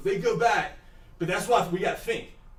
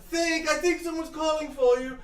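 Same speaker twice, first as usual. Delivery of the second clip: higher woman's voice; a townswoman calling mildly; a hint of a woman calling; lightly voiced woman; 9 semitones higher than usual. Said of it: "He goes".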